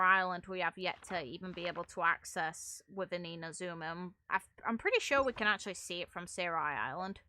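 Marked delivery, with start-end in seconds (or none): none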